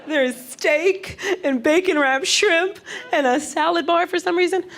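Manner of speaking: put-on overwhelmed child seeing all the food; high wide-eyed wonder; kid at a buffet. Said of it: "you're like a little kid".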